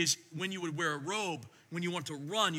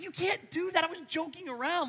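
high, rushed dad backpedalling; alarmed protest; 9 semitones higher than usual. Retold or imitated. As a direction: scramble to take the joke back. Father alarmed at son.

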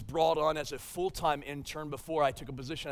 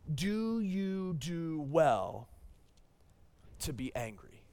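God's gentle question; much slower than usual. Acted. Pastor voicing God's question to angry Jonah, slow and hushed. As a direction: let each word hang, a calm divine rebuke. God's reply read slowly.

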